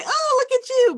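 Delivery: gushing welcome. Retold or imitated. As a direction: coo at the arriving guests. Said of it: "let people in and say".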